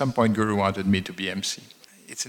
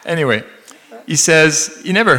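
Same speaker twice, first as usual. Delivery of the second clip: laughing; 6 semitones higher, 6 dB louder than usual. Real, not acted. Still laughing.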